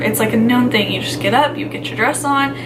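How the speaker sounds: normal talking voice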